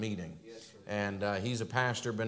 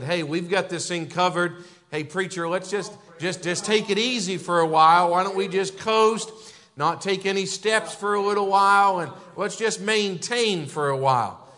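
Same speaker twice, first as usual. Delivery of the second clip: higher, louder mock church member wanting to coast; complacent churchgoer; 7 semitones higher, 8 dB louder than usual. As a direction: play the lazy congregation begging the preacher to relax.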